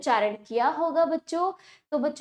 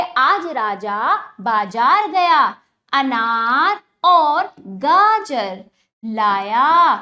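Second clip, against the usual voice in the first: slow drawn-out reading; 7 dB louder, much slower than usual. Shown as a demonstration. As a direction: stretch every आ in आज राजा बाजार, slow and loud. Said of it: "और ज्यादा खींच के पढ़ा जाएगा". (बच्चों के लिए आ खींचकर धीरे और ज़ोर से पढ़ना)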